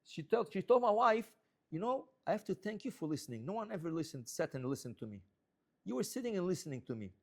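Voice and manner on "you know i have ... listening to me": put-on quiet, grateful old lady; soft grateful voice. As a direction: speak softly like a thankful old woman.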